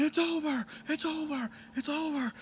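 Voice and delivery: high-pitched imitation of a screaming wrestling commentator. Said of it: "and J R screaming".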